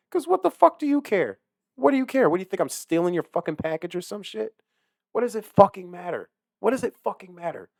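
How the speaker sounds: loud, high indignant rant; angry rant. Real, not acted. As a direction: vent it furiously.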